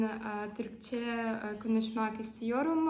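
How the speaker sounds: ordinary talk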